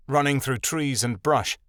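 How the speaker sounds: ordinary delivery, nothing marked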